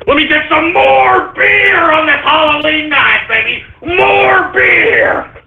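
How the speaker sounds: rowdy Halloween beer shout. Real, not acted. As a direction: belt it out like a party cheer.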